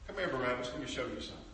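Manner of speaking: low, soft invitation; guard's gentle aside. Acted. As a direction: draw the prisoner aside softly. Guard gently takes prisoner aside.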